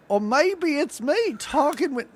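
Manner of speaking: put-on high, loose stab at a Southern accent; attempted Southern accent. Podcast host joking with her co-host, in an attempted Southern accent. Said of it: "that was me attempting a Southern accent".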